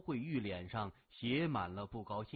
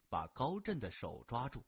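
ordinary delivery, nothing marked

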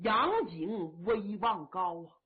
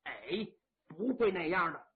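put-on steady old marshal; 6 semitones lower than usual. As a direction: speak as the grave elder brother calming his men. Yang Liulang voiced in story.

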